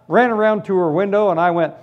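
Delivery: usual speaking voice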